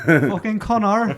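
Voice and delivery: put-on Cork accent. Quoting Young Offenders characters.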